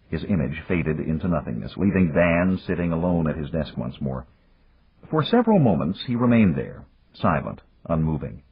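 ordinary delivery, nothing marked

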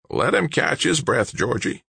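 high grinding rasp, dropping on the last word; raspy old man. A narrator's reading. Old man holding back Big George, raspy voice. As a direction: rasp it high to restrain a friend. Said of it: "His voice sounded like an old-time diesel rig".

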